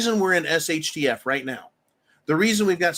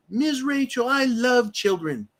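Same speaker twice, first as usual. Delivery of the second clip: mock sugary kids' host; 9 semitones higher, slower than usual. Mocking children's entertainer sweetly.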